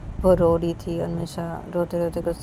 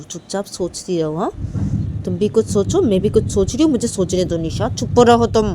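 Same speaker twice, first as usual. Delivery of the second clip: shouting hush; 4 semitones higher, 7 dB louder than usual. Acted. Manisha to Nisha, loudly ordering silence while thinking. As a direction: yell at her to shut her mouth.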